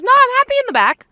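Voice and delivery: high, loud and fast prissy mimic of a friend; mocking friend. Mimicking Sam refusing the front seat, high mocking voice. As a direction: parrot her polite refusal in a silly high voice.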